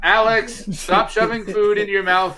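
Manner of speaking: high, loud scolding mother. Acted. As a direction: tell him off like a mom.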